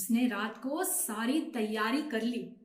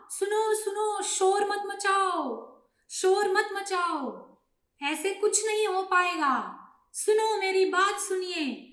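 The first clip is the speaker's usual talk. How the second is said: high, raised-voice command; urgent storybook elder taking charge; insistent calling out; 7 semitones higher, 4 dB louder than usual. Acted. Old pigeon calls over panicking flock.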